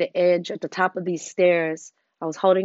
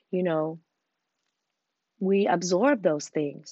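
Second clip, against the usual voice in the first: ordinary talk, nothing marked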